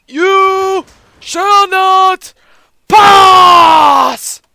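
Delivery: very slow, high, drawn-out shout; hammed-up heroic roar, each word stretched; epic bellow. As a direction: bellow it like a wizard barring the way. Hammed-up wizard line while defending.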